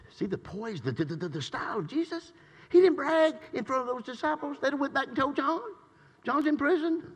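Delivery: loud, high, fervent preaching shout; full-force preaching. Acted. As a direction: preach it out with everything you have.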